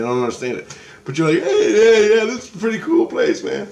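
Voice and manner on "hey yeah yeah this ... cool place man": high, louder, easygoing dude voice; laid-back Rusty. Retold or imitated. Rusty admiring the place, a high easygoing dude voice. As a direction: play the chill friend who's impressed.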